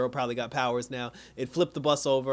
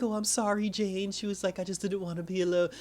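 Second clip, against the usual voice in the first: small, high-pitched pleading imitation; mock pitiful apology; 5 semitones higher than usual. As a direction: plead in a little high voice, lightly acted. Retold dying girl apologizes to Jane.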